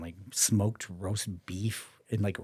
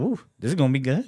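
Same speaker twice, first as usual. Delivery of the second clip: higher, louder and fast, delighted; gleeful ooh; 6 semitones higher, 8 dB louder, faster than usual. Retold or imitated. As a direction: savour it, rubbing your hands. Excited anticipation.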